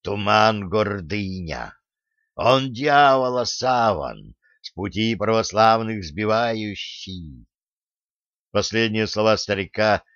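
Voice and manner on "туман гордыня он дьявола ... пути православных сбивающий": slow, drawn-out, solemn warning; slow prophetic pronouncement; ominous prophecy. Acted. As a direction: intone it slowly as a grim omen. Volkhv's doom-laden warning.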